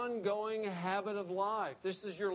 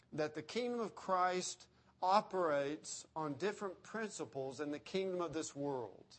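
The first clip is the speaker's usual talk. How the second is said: ordinary talk, nothing marked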